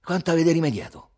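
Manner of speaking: high, loud, sour question; grimacing question. A narrator's reading. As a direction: ask it through a grimace. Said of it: "fece una smorfia Tommaso".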